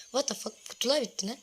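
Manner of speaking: usual speaking voice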